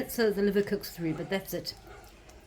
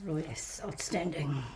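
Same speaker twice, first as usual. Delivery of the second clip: whispering; 5 semitones lower, 7 dB quieter, slower than usual. Real, not acted. Tasting sauce, quiet delight.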